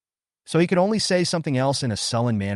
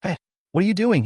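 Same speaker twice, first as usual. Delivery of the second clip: high, fast alarmed cry; panicked; 9 semitones higher, 3 dB louder, faster than usual. A narrator's reading. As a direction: blurt it out in panic. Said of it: "a little panicked".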